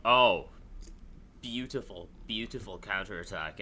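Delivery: ordinary talk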